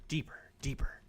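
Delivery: breathy unvoiced whisper drawing you in. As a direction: whisper it like a hypnotist. Guiding deeper into flavors.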